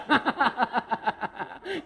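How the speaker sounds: high, rolling laughter; laughing. Real, not acted. Speaker sharing the joke with a man, high laughter. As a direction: burst out laughing again and let it run. Cracking up with audience member.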